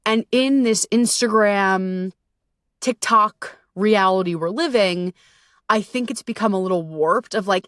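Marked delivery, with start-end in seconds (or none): none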